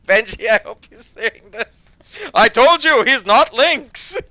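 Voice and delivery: loud teasing shout at a friend. Real, not acted. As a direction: yell it at Benji, triumphant. Calling out friend Benji.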